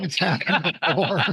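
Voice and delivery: amused echo, breaking into laughs; laughing. Real, not acted. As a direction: repeat it through real laughter. Amused repeat of the story's punchline.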